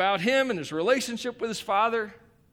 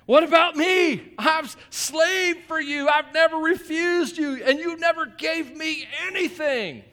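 put-on higher, louder childish whine, dragged out; whiny furious son; 7 semitones higher, 4 dB louder, slower than usual. Older son whining at his father, childish and furious. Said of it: "the older brother, furious".

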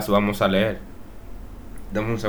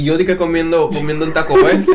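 laughing; 6 semitones higher, 5 dB louder than usual. Real, not acted. Laughs telling about food.

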